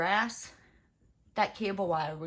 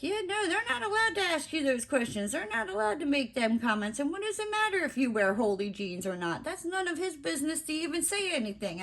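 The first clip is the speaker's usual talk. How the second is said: higher, fast indignant supervisor; lightly voiced indignant supervisor; 7 semitones higher, faster than usual. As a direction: rattle it off like an outraged supervisor. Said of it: "she's like".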